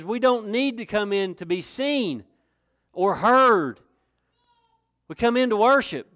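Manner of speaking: rising to loud, high preaching at full force; fiery preaching. Acted. Preacher warning the congregation about church as show, fiery and loud. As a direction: build it up and hammer it home.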